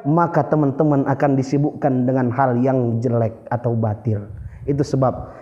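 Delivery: low, solemn speech closing; penutup pidato. Shown as a demonstration. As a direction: close the talk gravely, ending in a prayer. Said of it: "Ada yang namanya closing time". (penutup khidmat bernada rendah)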